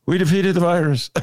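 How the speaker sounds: half-hearted Biden voice, then gives up; Joe Biden imitation. Host doing a quick Biden for the producer, lightly voiced. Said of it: "here's my Joe Biden imitation".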